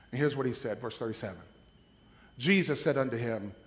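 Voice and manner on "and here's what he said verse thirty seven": ordinary talk, nothing marked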